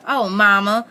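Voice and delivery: mild, loud mock shriek; protesting shriek. A narrator's reading. Bess calling protest from the front room, mild shriek. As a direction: protest loudly, pitch lifted. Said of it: "shrieked with protesting laughter".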